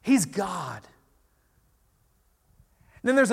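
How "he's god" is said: louder astonished outburst; mock amazement; amazed disciples. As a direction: awestruck realization. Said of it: "they're like".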